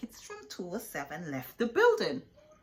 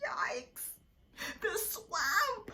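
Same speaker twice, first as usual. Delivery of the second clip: high frightened yelp; panicked kids gasping; afraid; much slower than usual. A narrator's reading.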